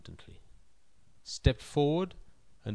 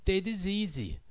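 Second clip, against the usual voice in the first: slow, knowing punchline, dragged out; 8 semitones higher than usual. Acted.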